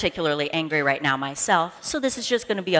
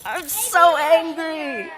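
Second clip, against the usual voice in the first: slow, much higher, drawn-out mock anger; wiggly mock anger; 10 semitones higher, 5 dB louder, much slower than usual. Shown as a demonstration. Leader wiggles her arms for the kids, slow and high. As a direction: wiggle the words out slowly. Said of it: "You gotta wiggle".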